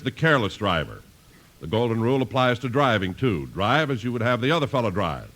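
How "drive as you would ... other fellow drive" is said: normal talking voice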